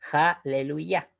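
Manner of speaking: slow, divided "aleluya" ending on a stressed "ya"; syllable by syllable. Shown as a demonstration. Teacher repeating the Hebrew word for students, slow "aleluya". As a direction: spell out "aleluya" slowly by syllables. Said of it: "con acentuación en la última sílaba".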